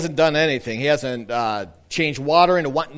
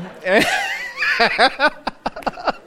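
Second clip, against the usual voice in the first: high laugh; 3 dB louder than usual. Real, not acted. Wardrobe malfunction with baby.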